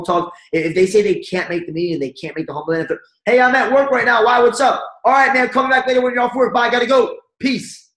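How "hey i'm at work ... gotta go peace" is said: high, quick and hurried, wrapping up the call; eager rush-off on the phone, much higher than usual; excited rush. Shown as a demonstration. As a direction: sound busy and excited, then hang up. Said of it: "was he so excited about, in a rush about".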